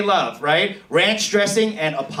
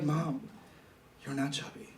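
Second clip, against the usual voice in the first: much softer, tender reassurance; mock gentle comfort; 2 semitones lower, 16 dB quieter than usual. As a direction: soothe her like a caring child. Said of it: "be like".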